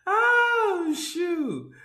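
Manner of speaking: very high, squealed out of laughter; high-pitched, amused release; tickled exclamation. Real, not acted. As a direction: wail it, still cracking up. Recovering from a laughing fit.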